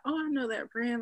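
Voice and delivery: light, excited recognition; a fan spotting the brand; imitating fans. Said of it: "and people like".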